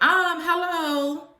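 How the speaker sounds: drawn-out, high, loud "Um, hello"; sarcastic aggravated hello, stretched out slowly; exasperated, mocking hello. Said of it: "I was aggravated".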